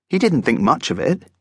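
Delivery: usual speaking voice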